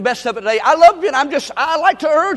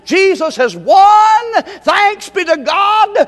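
slow, loud, high proclamation; exultant shout; 7 semitones higher, 5 dB louder, much slower than usual. Real, not acted. Preacher celebrating victory to the congregation, loud and slow.

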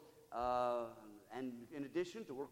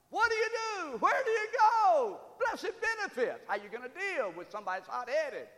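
preaching at full force; 13 semitones higher, 9 dB louder than usual. Acted. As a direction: preach it out to the back pews. Preaching climax.

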